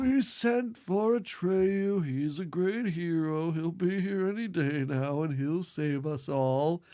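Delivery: slow, quavering elder; a tired old sage promising rescue; old man voice. Retold or imitated. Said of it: "doing the old man voice".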